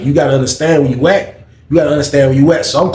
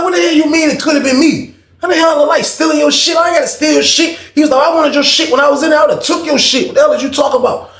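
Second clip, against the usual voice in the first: shouting back in disbelief at the accusation; retold furious yelling; 11 semitones higher than usual. Cellmate accused of stealing, Mike explodes.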